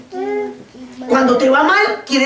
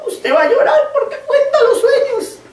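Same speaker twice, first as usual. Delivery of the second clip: high, laughing-crying voice; retold tearful warning; 14 semitones higher than usual. Intercessor weeping as she warns Martica, high and broken. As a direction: let the words break high through tears. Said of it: "ella lloraba y me decía".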